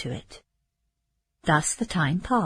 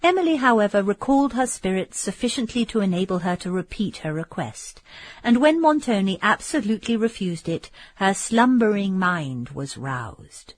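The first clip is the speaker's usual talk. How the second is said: ordinary delivery, nothing marked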